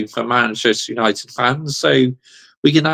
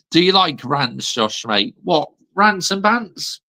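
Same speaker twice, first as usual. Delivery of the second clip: ordinary talk, nothing marked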